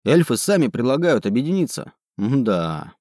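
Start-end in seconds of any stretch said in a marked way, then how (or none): none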